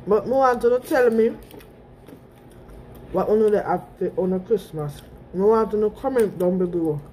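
speaking between bites; talking while chewing. Real, not acted. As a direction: mouth partly full. Seen 0: holds burger throughout, bites near 4.6, then talks while chewing.

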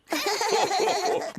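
high, jolly belly laugh; hearty laugh. Acted. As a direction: laugh out, amused by the kids. Daddy Pig laughs at rocket.

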